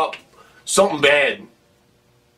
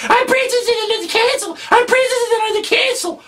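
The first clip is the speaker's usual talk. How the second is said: very high, fast sing-song mimic; mocking kid voice; faster than usual. [name] mocks the kid's line, high and fast. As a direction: parrot the kid bitterly.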